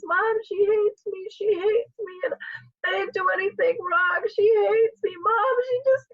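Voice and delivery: very high, crying plea; imitated tearful wail. Daughter runs to mom after being screamed at, imitated tearful wail. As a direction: imitate a distraught daughter wailing to her mom. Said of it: "her lips are quivering".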